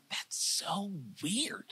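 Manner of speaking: breathy whisper to herself, slow. Retold or imitated. Whispering to herself about the snake at Home Depot. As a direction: hush it out, barely voiced. Retold inner thought, whispered.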